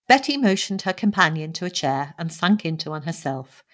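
ordinary delivery, nothing marked

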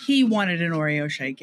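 ordinary talk

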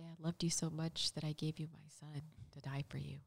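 soft reverent whisper. Acted. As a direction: breathe it like a secret. Quoting God's card reverently.

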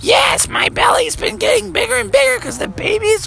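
very high falsetto mother; mom voice. Acted. Mom tells Froggy about the baby, high falsetto. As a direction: explain it in a squeaky-high mom voice.

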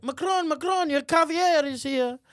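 mock butler call. Imagined aide interrupting Macron's speech, mock butler call. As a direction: announce it like a fussy butler. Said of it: "they would be like".

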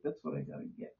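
unvoiced resigned whisper; whispered to himself. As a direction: breathe it out, resigned. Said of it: "I thought".

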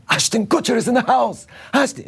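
high-pitched excited announcing yell; mock yell. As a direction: call it out high to the whole room. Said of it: "somebody yells".

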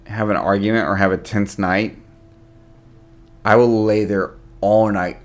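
ordinary delivery

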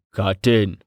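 low voice with a note of warning; a brief, understated caution. A narrator's reading. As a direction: warn him off, keeping it low. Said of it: "a note of warning in his low voice".

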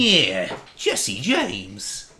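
cartoon cat sidekick voice, drawn-out words; swooping, stretched-out cartoon character voice; Meowth. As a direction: do a rough anime scheming-cat imitation. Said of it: "what Meowth actually sounds like in the Pokemon".

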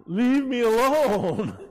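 slow, laughing leave me alone; laughing protest. Real, not acted. Pastor laughing off his wife's teasing, laughing. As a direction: laugh it off as you beg.

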